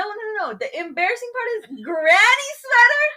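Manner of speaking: indignant but cracking up; laughing outrage. Real, not acted. Laughing indignation at the granny-sweater jab.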